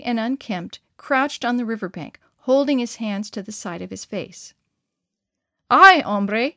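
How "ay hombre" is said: loud hail across a distance; calling out. A narrator's reading. Pedro hails a stranger on the riverbank, calling out. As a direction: call out to get his attention. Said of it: "Pedro called".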